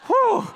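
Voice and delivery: very high, loud "whew"; high loud whoop. Real, not acted. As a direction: whoop it out at the top of the voice. Whoops after the movie guessing.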